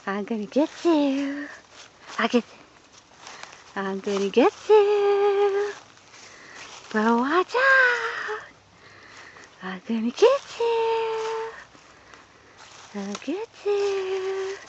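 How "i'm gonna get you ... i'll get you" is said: playful slow teasing. Pretend. Playful chase threat.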